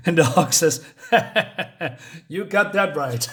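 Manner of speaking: reading through laughter; laughing. Real, not acted. The reader laughs while reading the Hulk's reply, laughing voice. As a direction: giggle through the line. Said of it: "laughing while reading".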